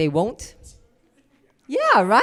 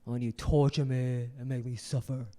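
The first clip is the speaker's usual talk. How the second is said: low, soft man's voice; 5 semitones lower, 7 dB quieter than usual. Retold or imitated. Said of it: "He said".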